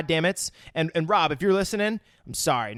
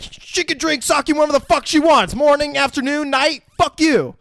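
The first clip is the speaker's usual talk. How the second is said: high, loud, irate rant; mock fury, slowing on the last words; imitated angry outburst; 10 semitones higher, 6 dB louder than usual. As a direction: act out a furious retort, hammed up. Acted quarrel over sake.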